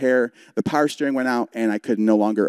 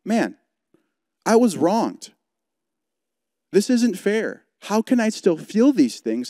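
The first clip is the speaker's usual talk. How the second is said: higher, pained self-questioning; anguished inner thought; 8 semitones higher, slower than usual. Retold or imitated. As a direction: cry out inwardly, hurt and bewildered. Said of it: "as I was laying there in anger".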